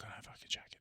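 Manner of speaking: urgent whispered aside, barely voiced; panicked whisper. Uncomfortable friend whispering to mate about the jacket, panicked. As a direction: whisper it so the staff won't hear.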